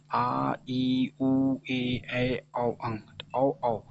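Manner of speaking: each vowel from आ to औ held long; long vowels listed with gaps between. Shown as a demonstration. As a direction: recite आ, ई and the rest one by one, drawn out. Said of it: "दुगुना समय लगता हो".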